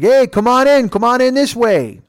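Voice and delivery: imitating a loud drunk waving people in; high, loud holler; rowdy calling out. Bubba calling friends in past security, rowdy holler.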